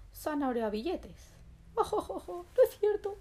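plain reading voice

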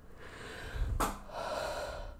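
long unvoiced sigh; whispered sigh. Real, not acted. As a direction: let out a big tired breath. ASMR, out of breath.

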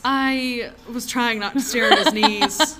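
real embarrassed laughter; laughing embarrassment. Real, not acted. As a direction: giggle out the awkward confession.

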